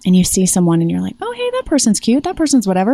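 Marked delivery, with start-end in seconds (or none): 1.2-2.9 high-pitched "oh, hey" easing into casual; perky interest. Acted. Sailor spotting someone cute in port, perky interest. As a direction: act out noticing someone cute, starting high.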